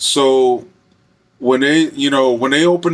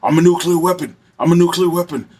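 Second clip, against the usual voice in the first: higher, insistent mock chant; pitched-up impression of a disturbed man; imitating a ranting inmate; 6 semitones higher than usual. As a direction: announce it like a crazed boast. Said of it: "And then he would go".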